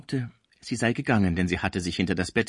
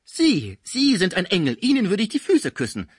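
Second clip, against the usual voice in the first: higher and louder, overflowing flattery; shrill gushing housekeeper; 9 semitones higher, 6 dB louder than usual. A narrator's reading. Cibot gushes over Schmucke, higher and louder. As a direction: melodramatic fawning.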